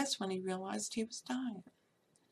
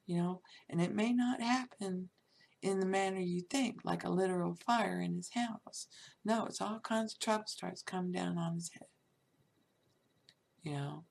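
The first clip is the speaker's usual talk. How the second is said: normal talking voice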